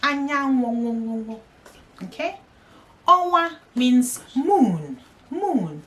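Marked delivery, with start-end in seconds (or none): 0.0-1.4 Anyanwụ stretched slowly, syllable by syllable; slow teaching repeat of Anyanwụ; slow drawn-out. Shown as a demonstration. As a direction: draw Anyanwụ out slowly for learners. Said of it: "Anyanwụ means sun".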